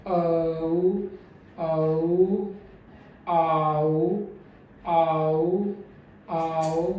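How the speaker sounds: औ then आओ, kept clearly separate; diphthong drill. Shown as a demonstration. Teacher drills औ and आओ side by side. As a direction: contrast the two glides. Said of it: "अब हमें इसे segregate रखना है".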